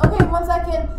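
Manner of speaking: calling out. Real, not acted. Calling out to banging door.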